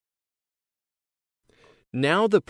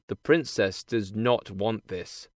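usual speaking voice

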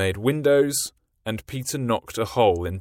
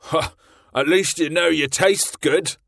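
high, louder cheeky sidekick; chirpy mate teasing his captain; Smee the sidekick; 7 semitones higher, 4 dB louder than usual. A narrator's reading. Smee's lines.

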